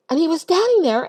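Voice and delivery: ordinary talk, nothing marked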